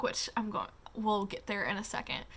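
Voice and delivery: a quick whispered aside. Real, not acted. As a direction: drop to a whisper, not yet. Aside about the epilogue.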